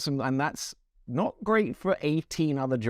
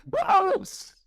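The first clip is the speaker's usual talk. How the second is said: high-pitched mock scream; Yuki. Imitating a driver's scream for co-hosts, high comic screech. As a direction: shriek it playfully, far above normal pitch. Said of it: "is for Yuki's scream".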